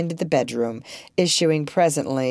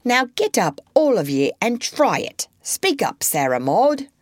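high commanding voice; bossy mother commanding; 8 semitones higher than usual. A narrator's reading. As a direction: order them to rehearse. Mother ordering rehearsal.

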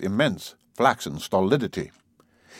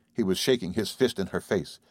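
ordinary delivery

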